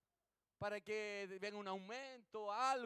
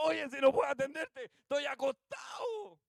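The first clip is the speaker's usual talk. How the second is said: acted shout, high and loud; shouting from inside; 6 semitones higher, 11 dB louder than usual. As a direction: holler back at a caller from bed. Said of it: "el otro de adentro le grita". (gritando desde adentro de la casa)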